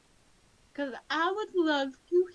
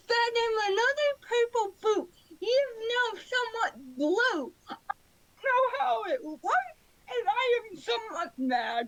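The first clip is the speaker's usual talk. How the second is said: mock whining; 9 semitones higher than usual. Frustrated over pet's colour.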